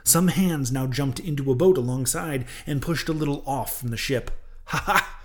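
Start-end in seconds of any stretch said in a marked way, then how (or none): 4.7-5.2 high, gleeful shout from above; triumphant cry. A narrator's reading. Harpooner spotting the rescue from overhead, gleeful cry. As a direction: crow with sudden delight. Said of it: "cried Daggoo".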